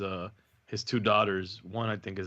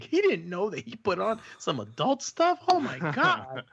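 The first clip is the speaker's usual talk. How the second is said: higher, mock teasing kids; mocking girls; 9 semitones higher than usual. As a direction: tease him like giggling girls. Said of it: "laughing at me".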